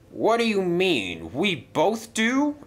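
high, rising disbelief; incredulous question. Acted. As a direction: challenge it, stretching the last words upward. Freddy questions the claim in disbelief.

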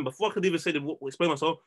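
usual speaking voice

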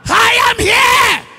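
high, forceful preacher's shout; crying out. Acted. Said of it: "Moses cried out".

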